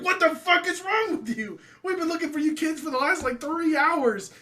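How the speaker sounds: high mock scolding, laughing through; an exasperated mom telling off her kid. Mother scolds her son for vanishing, high and laughing.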